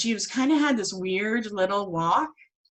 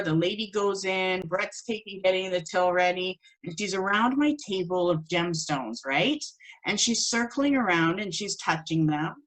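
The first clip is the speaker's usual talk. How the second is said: ordinary talk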